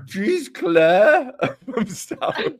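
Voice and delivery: high, loud, drawn-out mock reproach; laughing, exaggerated indignation; mock outrage. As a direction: scold her in fun through laughter. Reacts to letter insulting Alex's accent.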